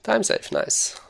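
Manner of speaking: sudden high, pleased exclamation; excited. Real, not acted. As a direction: pop up high with delight at the time save. Pleased by a successful skip.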